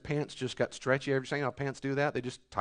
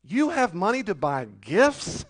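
higher, louder, slow outraged scolding; indignant rebuke; 8 semitones higher, 6 dB louder, slower than usual. Acted. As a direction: call out their ingratitude, slowly and loud. Preacher rebukes the complainers.

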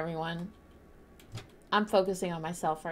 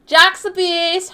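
drawn-out loud higher yell; playful shout; 11 semitones higher, 16 dB louder, much slower than usual. Real, not acted. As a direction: yell it slowly across the lobby. Teasing shout at teammate.

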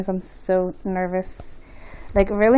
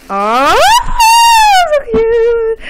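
high, loud squeal; cute squeal; 9 dB louder, much slower than usual. Real, not acted. First look at baby's head, a high loud squeal. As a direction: burst out at the cuteness.

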